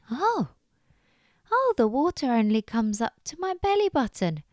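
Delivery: high, swooping amazed bunny; surprised realisation. Acted. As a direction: discover it with wide-eyed wonder. Bunny discovers shallow water.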